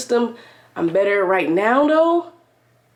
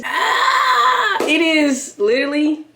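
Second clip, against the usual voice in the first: excited greeting; 7 semitones higher, much slower than usual. Real, not acted. Excited hype greeting to camera.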